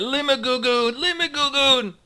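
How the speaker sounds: high-pitched chanted scream, held on the last syllable; screaming Kuo-toa. Acted. Game master voices Kuo-toa crowd for the players, screaming. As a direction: scream it out loud and high like a frenzied creature. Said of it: "Kuo-toa screaming".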